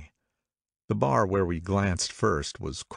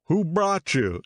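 demanding; 10 semitones higher, 3 dB louder, slower than usual. A narrator's reading. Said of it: "he demanded".

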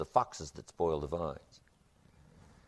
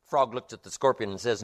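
ordinary delivery

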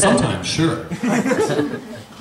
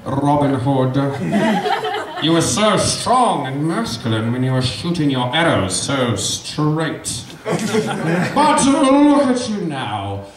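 gloating villain; slower than usual. Acted. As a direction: savour each word like a villain. Sheriff gloating over Robin.

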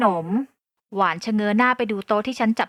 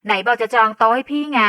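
loud, slow, confronting the vendor; ถามเสียงดัง; loud demand; 7 dB louder, slower than usual. A narrator's reading. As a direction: call her out loudly over the counter. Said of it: "ฉันถามเสียงดังใส่แม่ค้าขนม".

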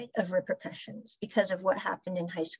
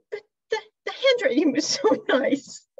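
higher, louder whine broken by laughter; laughing protest; 7 semitones higher, 5 dB louder than usual. Retold or imitated. Pat protesting to Pran about the note, laughing whine. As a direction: protest in disbelief while laughing.

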